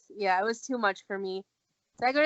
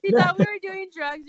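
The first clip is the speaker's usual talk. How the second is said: high, louder, through real laughter; laughing; 10 semitones higher, 8 dB louder than usual. Real, not acted. Telling a listener about mom's mistaken suspicion, laughing. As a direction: say it while cracking up.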